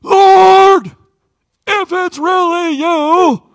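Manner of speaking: high, loud, drawn-out yell; full-voiced desperate call across the water; shouting over storm. Shown as a demonstration. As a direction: holler it over wind and waves. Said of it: "remember, it's wind, it's waves".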